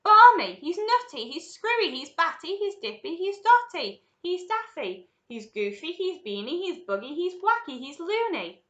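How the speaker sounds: high, loud volley of exclamations; frightened shouting. A narrator's reading. Parents shout in a frightened chorus about Wonka. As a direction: fire off one outcry after another. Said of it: "a chorus of frightened shouting".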